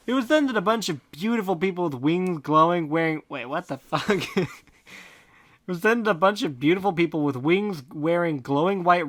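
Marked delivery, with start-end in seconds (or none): none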